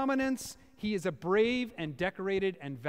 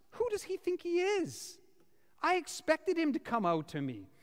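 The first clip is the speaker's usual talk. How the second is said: high, scornful rant about the prophet; offended general scoffing, much higher; indignant Naaman; 7 semitones higher than usual. Retold or imitated. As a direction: sneer at the snub like a wounded VIP. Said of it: "he says".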